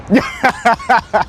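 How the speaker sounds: high burst of laughter; delighted laugh. Real, not acted. Viewers wave back.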